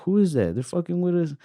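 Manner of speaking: high-pitched, disbelieving; mock-puzzled high voice at modest volume; retold bewilderment. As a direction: replay being caught off guard, pitch jumping up. Retold confused reaction to armed cops.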